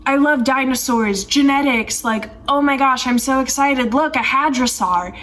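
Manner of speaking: mock an over-eager boy who loves dinosaurs; imitating an excited kid. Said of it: "He's, like, really excited".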